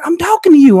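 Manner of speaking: mock-indignant wife, pitched high and loud; exasperated wife. Wife's retold impatient outburst.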